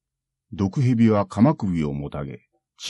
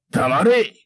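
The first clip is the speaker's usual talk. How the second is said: loud, much higher furious yell; angry shout; 10 semitones higher, 5 dB louder than usual. Acted. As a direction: bark it in rage. Samurai silences monk.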